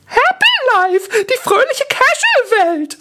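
very high, loud squeal; squeaky, cheery little-girl announcement; bubbly cartoon girl. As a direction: chirp it like a manga advert. Bubbly girl avatar announces her world.

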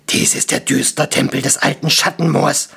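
rough-voiced firm dwarf statement; gruff, resolute dwarf voice. A narrator's reading. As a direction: declare it firmly as a gruff dwarf. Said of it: "erklärte Stramm fest".

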